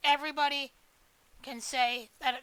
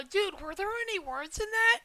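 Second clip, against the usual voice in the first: higher-pitched mock disbelief; a put-on voice asking if those were words; incredulous; 6 semitones higher than usual. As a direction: act baffled, as if nothing made sense. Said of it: "and you go".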